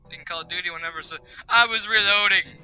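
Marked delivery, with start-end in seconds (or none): 1.5-2.6 loud, higher imitated complaint; mock indignant excuse. Gamer killed while reloading, mock indignant. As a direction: shout it like a cheated gamer.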